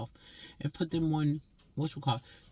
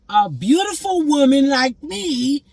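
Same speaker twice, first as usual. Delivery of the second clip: very high, very loud, slow preening; diva boast; 10 semitones higher, 12 dB louder, slower than usual. Real, not acted. Woman boasts of her beauty, slow loud diva voice. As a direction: sing it out like a vain diva.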